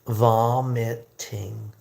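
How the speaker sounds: very slow, syllable by syllable, "Vomiting"; slowed-down pronunciation. Shown as a demonstration. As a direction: stretch out each syllable of "Vomiting" for learners. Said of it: "Let me go slower".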